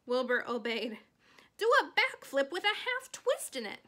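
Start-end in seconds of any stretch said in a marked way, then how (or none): 1.6-3.8 loud, high cry. Acted. Charlotte cries commands to the leaping pig, loud. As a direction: shout the command across the yard. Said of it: "cried Charlotte".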